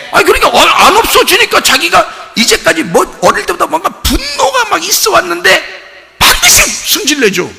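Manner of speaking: high, urgent preacher's voice; fervent sermon climbing high; impassioned preaching. Real, not acted. Preaches at full force.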